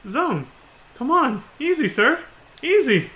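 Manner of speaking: urgent placating. Acted. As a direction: talk down a furious friend. Friend urging an angry man to calm.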